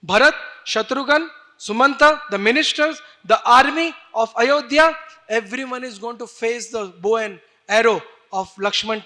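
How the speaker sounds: furious warrior calling out his enemies; out-of-control rage. Acted. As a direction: shout each name as a threat. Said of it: "totally out of control".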